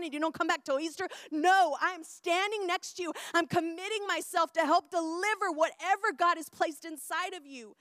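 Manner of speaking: loud, high, preaching insistence; fierce, unwavering promise at full volume; impassioned vow. Acted. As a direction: pledge it forcefully, easing off at the end. Preacher acts out a vow of loyalty.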